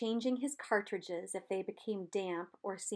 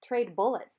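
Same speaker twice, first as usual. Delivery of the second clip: louder, wry call across the river; dry call; 2 semitones higher, 7 dB louder than usual. A narrator's reading. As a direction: toss the joke across the water. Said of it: "Charlie said".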